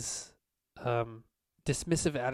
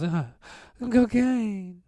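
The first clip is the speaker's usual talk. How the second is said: drugged groan; 10 semitones higher, 8 dB louder, much slower than usual. Acted. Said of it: "he just goes".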